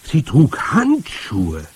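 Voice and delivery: sudden alarm, drawn out; alarmed realisation. A narrator's reading. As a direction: gasp out the horrified discovery.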